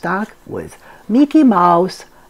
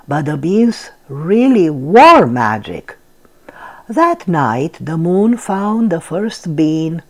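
plain reading voice

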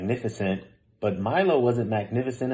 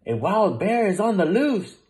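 alarmed; 8 semitones higher, 4 dB louder than usual. A narrator's reading. Panicked cab driver reports bear.

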